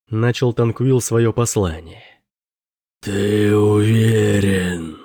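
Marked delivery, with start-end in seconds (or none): 3.0-5.0 slow, sing-song otherworldly question; demon voice. A narrator's reading. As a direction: draw it out like a demon. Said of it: "спросил демон".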